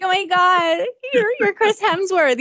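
high, drawn-out mock gushing; playful imitation of a thrilled fan; starstruck fan. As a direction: stammer it high like an awed fan.

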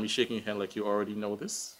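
ordinary delivery

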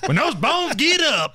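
high, sing-song fake Southern preacher tone; mock Southern accent. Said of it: "you ever hear that accent come out".

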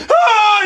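high loud startling scream; startled scream. Retold or imitated. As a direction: yell out suddenly. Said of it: "J'ai fait comme".

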